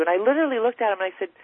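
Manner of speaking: ordinary delivery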